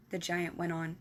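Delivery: plain reading voice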